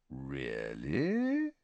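soft, low, drawn-out question; quietly menacing. Acted. Snape answering Slughorn, softly and low. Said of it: "said Snape quietly".

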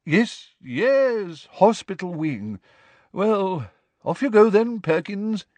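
flustered, high-voiced professor; wrong-footed. A narrator's reading. Professor sending pupil to hospital wing, flustered. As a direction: dither in a high voice, then dismiss the pupil. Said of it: "clearly very much wrong-footed".

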